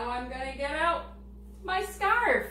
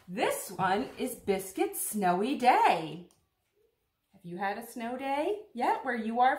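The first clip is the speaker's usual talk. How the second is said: bright storybook read-aloud; reading to children; 6 dB louder than usual. Acted. Teacher reading Biscuit's Snowy Day to children, storytelling. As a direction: read it warmly to a circle of kids.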